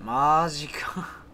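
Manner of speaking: slow breathy whispered disbelief. Real, not acted. Player hiding from the monster, whispering.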